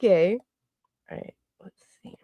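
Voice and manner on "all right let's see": fast whisper to yourself; quick whispered mutter; whispered aside. Real, not acted. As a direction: rush it under the breath. Muttering while working.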